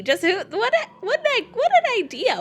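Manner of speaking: excited. Real, not acted. Gushing about the drink concept.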